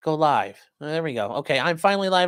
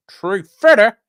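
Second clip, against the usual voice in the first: put-on voice; 6 semitones higher, 11 dB louder, slower than usual. Repeats a viewer's nickname.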